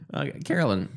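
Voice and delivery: ordinary delivery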